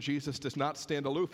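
normal talking voice

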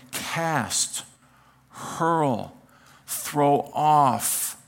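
"Cast", "hurl", "throw off" each set apart by pauses; deliberate pauses around "Cast", "hurl" and "throw off"; emphatic separate words. Shown as a demonstration. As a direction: land "hurl" alone, then pause. Preacher stressing each verb.

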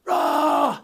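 high mock roar; angry roar. Shown as a demonstration. Preacher showing how punishment sounds, mock roar. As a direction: bark out a short angry roar. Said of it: "Punishment calls out".

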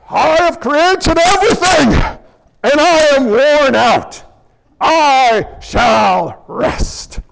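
God voiced as if exhausted, loud and drawn out; booming, worn-out God, last words dragged slowly; mock weary God. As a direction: ham up a tired God ready to nap.

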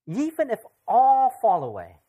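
loud, high-pitched declaration; emphatic vow. Acted. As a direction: vow it boldly, leaning hard on ALL. Acts Peter's emphatic vow to Jesus.

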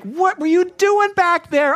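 high, loud, slow mock outrage; hammed-up huff at the fast food worker; mock impatient customer. Pastor mocking himself as an impatient customer, hammed-up outrage. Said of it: "it's like".

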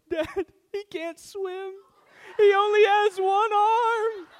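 put-on high, slow child's voice, rising to a loud laughing cry; panicked little daughter. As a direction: play the worried little girl shouting to dad. Little daughter calling from corner.

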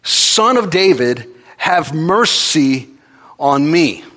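loud, high, slow desperate cry. Retold or imitated. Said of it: "he cries out all the more".

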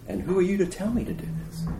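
soft, high, quick aside; hushed challenge. Acted. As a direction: ask it under your voice, high and quick. Repeats Moses' challenge, hushed.

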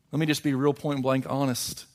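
ordinary talk

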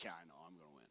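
soft, offhand cocky reply; cocky mutter. Retold or imitated. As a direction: shrug it off, sure of the win. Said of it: "He's like".

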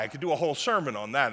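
ordinary talk, nothing marked

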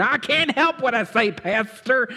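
high-pitched mock complaint from a churchgoer; whiny excuse. Churchgoer excusing his tongue to the pastor, high mock whine. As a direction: plead helplessness in a high, mocking voice.

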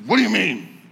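heated lunch-table comeback; retold angry snap. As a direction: throw it back at him, worked up. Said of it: "get very angry".

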